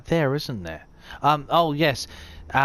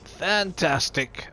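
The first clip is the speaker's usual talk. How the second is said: speaking through the hiccups; interrupted by small hiccups; 4 dB quieter than usual. Real, not acted. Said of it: "now I've got the hiccups".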